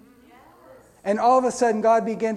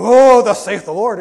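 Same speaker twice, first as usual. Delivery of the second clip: loud hammed-up prophet boom; mock super spiritual proclamation; 3 semitones higher, 14 dB louder than usual. Mocking the showy prophet style, loud hammed-up proclamation. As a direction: belt it like a showy Pentecostal prophet. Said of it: "his super spiritual thing".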